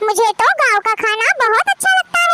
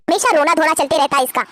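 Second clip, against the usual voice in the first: ordinary talk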